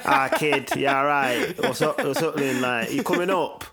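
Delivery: put-on loud, cheery Manchester lad accent; Manc accent. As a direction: call out like a Manc kid, Manc accent. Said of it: "a deep Manc accent".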